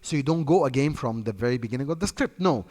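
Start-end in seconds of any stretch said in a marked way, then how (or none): none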